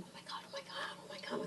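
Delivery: imitated hushed, voiceless panic; urgent whisper. Girlfriend tapping her friend beside Spike Lee, urgent whisper. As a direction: whisper it urgently, as a retold aside.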